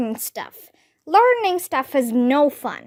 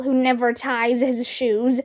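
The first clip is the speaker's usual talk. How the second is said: usual speaking voice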